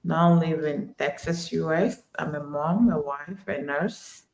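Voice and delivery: normal talking voice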